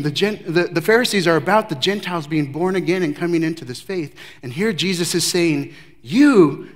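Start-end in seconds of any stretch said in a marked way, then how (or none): 6.0-6.7 "You" hit louder, singling him out; pointed you. Acted. Jesus to the teacher of Israel, a pointed "You".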